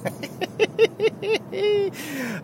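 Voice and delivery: high, drawn-out giggle; laughing. Real, not acted. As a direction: crack up at your own imitation. Cracks up after his imitation.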